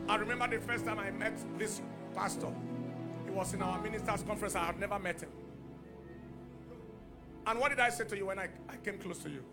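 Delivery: ordinary talk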